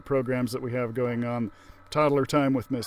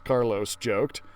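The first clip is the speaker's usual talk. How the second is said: plain reading voice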